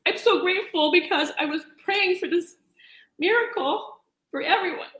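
tearful and grateful, fighting to hold it together; emotional, near tears. Real, not acted. Guest to the host, tearfully thankful for the miracle. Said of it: "I don't know why I'm so emotional today".

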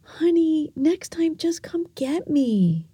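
lightly voiced uncle reassuring her; gentle uncle. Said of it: "he looks at me and he's like".

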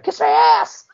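[name] quote. Retold or imitated. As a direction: throw out the famous line like a diva. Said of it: "that one line that they used to use".

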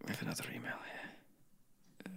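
unvoiced hushed whisper. Real, not acted. Reading listener email in a whisper. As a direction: breathe it out as a whisper. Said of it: "I don't know why I whispered that".

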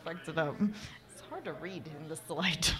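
soft muttered aside; quiet aside. Real, not acted. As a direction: drop out, mutter it.

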